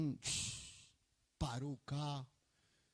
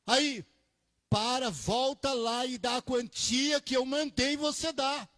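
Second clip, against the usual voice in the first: louder, higher divine order; a raised voice ordering him to turn back; commanding voice of God; 7 semitones higher, 5 dB louder than usual. Retold or imitated. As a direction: call the man back with authority. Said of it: "o Senhor disse assim".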